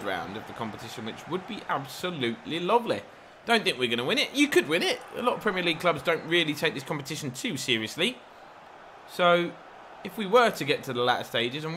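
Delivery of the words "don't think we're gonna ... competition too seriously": ordinary delivery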